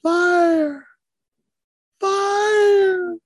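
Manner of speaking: high, drawn-out cry from far off; long wailing alarm call; distant fire cry. Retold or imitated. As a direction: call out an alarm across a dark campground. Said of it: "I heard this voice off in the distance".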